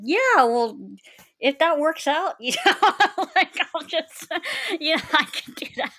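high, giggling yes through laughter; laughing reply. Retold or imitated. Answering the registrar about a free degree, giggling high.

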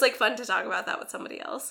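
ordinary talk

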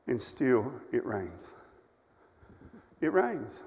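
a preacher gone soft for the lesson; hushed preaching. Acted. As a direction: let it sink in quietly. Preacher drops to hushed reflection.

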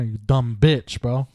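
usual speaking voice

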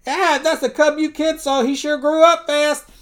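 loud, high comic holler; panicked yell. Acted. Donald meeting mama bear, high loud mock panic. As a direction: yell in cartoon alarm, voice shooting up.

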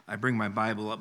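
ordinary delivery

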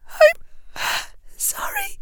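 high, gasping tearful apology; said in gasps. A narrator's reading. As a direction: gasp it out, voice vanishing. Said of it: "Hermione said in gasps".

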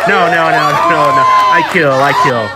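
cheesy stand-up comic voice. Acted. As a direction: play a hacky club comic. Mock stand-up comic routine.

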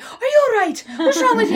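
high mock concern, imitating the driver. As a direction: ask high and worried, as if to a crying tourist.

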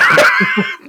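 sudden squeaky laugh; high laugh. Real, not acted. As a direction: burst into laughter. Laughing at the movie scene.

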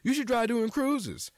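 high, mock-eager pushy voice; lightly voiced nagging friends; mocking everybody. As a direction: parody the people nagging about cruises.

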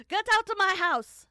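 very loud, high hammed-up shout; mock furious shout. Cook throwing the investigator out, mock furious full shout. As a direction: bellow it as an over-the-top outraged cook. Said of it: "that's not what she sounded like".

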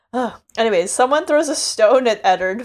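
normal talking voice

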